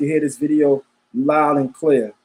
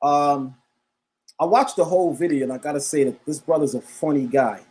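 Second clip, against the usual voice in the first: ordinary talk, nothing marked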